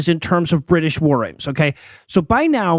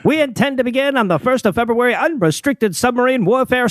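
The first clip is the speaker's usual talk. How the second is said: put-on higher, formal old telegram reading; old-timey voice; 5 semitones higher than usual. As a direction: read it like an old newsreel announcer. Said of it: "in an old-timey voice and say "stop".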